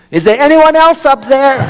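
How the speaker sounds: very high, loud comic shout; punchline cried out in mock panic. A narrator's reading. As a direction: yell up desperately for someone else. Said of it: "the man shouts out".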